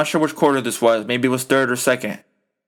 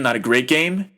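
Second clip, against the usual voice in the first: ordinary talk, nothing marked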